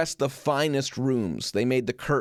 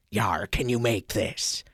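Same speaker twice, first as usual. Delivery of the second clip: put-on slow, lower pirate-accent growl; a revolutionary talking like a pirate; 4 semitones lower, slower than usual. Revolutionary asking the seamstress about the flag, pirate accent. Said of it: "I don't know if that was his real accent".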